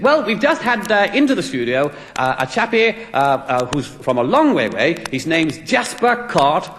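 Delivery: high, bouncy radio presenter impression; on-air radio DJ. DJ introducing his studio guest on air, mock on-air presenter voice. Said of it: "going on to the air live now".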